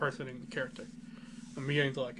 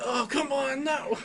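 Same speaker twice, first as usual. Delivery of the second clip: much higher, loud cry of protest; dismayed shout; 12 semitones higher, 4 dB louder, slower than usual. Retold or imitated. Reliving watching the stabbing scene, a high loud dismayed shout. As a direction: yell at the screen as the hero gets hurt.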